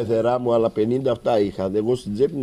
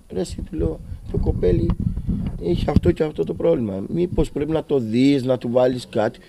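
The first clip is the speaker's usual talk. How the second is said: raised, higher pitched demand at the doctor; angry confrontation; 6 semitones higher, 3 dB louder than usual. Retold or imitated. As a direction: press the doctor hard, voice raised. Father confronts doctor about child. (θυμωμένη, υψωμένη φωνή στον γιατρό)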